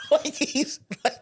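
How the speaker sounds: high, laughing through words. Real, not acted. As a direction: barely talk through laughter. Cracking up over the story.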